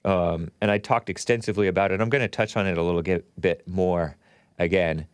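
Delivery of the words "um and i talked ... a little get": normal talking voice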